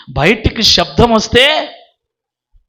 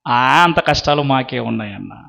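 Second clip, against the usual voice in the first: low, soft mock reply of an unbothered listener; dismissive reply; 7 semitones lower, 6 dB quieter than usual. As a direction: brush the question off under your voice. Voicing listener's dismissive answer. (పట్టించుకోనట్టు తగ్గు స్వరంతో)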